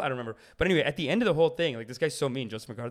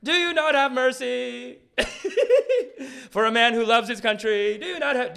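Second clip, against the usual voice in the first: high, loud hammed-up oratory; mock Senate monologue; 10 semitones higher, 6 dB louder than usual. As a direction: thunder a pompous plea to the Senate. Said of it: "doing monologues like this in the Senate".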